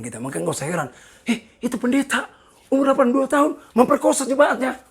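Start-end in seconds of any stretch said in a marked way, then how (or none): 1.3-4.8 high, loud imitation of scandalised gossip; mock shocked gossip. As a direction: exclaim it like startling news. Acting out shocked gossip about pastor. (tiruan orang kaget, tinggi dan lantang)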